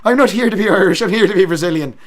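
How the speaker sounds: high, loud, hammed-up Irish accent; put-on Irish accent. Streamer answering a chat request, loud put-on Irish accent. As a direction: belt it out in mock Irish. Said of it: "can you do your Irish accent".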